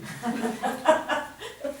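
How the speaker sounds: bright burst of real laughter; a quick high delighted laugh. Real, not acted. Laughs at her own request.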